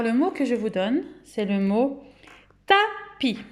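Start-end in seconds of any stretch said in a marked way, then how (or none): 2.7-3.4 "tapis" split into ta and pi, first syllable high. Shown as a demonstration. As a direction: present "tapis" in two separate syllables. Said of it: "combien il y a de syllabes".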